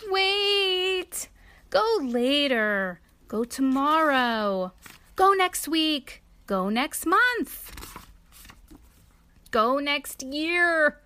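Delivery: high, slow pleading list; begging. A narrator's reading. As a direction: stretch each plea out. Elephant begs Piggy to delay.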